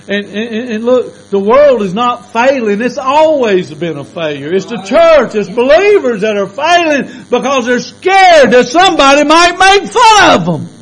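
high, loud climbing sermon shout; preaching at full force. Acted. Preacher rousing the church, full force shouting. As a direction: build to a preaching roar.

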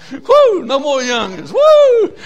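very high, loud church whoops; exultant pulpit shout; jubilant whooping. Real, not acted. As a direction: whoop it out in celebration. Preacher whooping in praise.

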